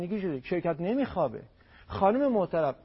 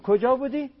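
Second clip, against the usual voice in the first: suspicious spouse asking, higher; mock jealous question; accusing spouse; 7 semitones higher, 5 dB louder than usual. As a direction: demand where they were. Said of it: "چماق درست نکنید".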